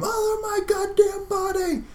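very high, slow anguished cry; father crying out in high, slow pain; scream of pain. Acted. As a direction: wail as if just shot. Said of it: "your father scream".

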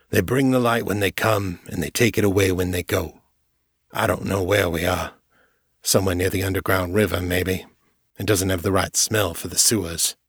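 lightly voiced older man, tired; weary prisoner. Silas explaining the cell to Jared, mildly weary. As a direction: tell it like you've been stuck here for weeks.

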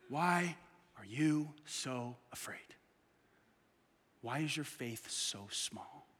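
slow, hushed whisper; a quiet whispered rebuke; whispered question. Shown as a demonstration. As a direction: breathe the words out softly. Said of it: "whispered repeat".

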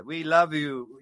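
usual speaking voice

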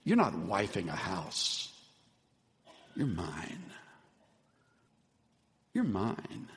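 husband tenderly reassuring his wife. Retold or imitated. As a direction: murmur it close to her. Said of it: "and said tenderly".

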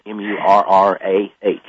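slow letter by letter; spelling it out. Shown as a demonstration. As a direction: spell out M U R R A H slowly. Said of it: "a correction, please".

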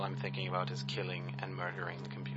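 hushed sinister whisper; whispered threat. Acted. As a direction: whisper it like a dark secret. Murderous thought spoken aside.

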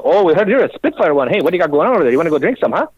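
hammed-up Scottish brogue; Scottish accent. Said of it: "what fucking English you people are speaking".

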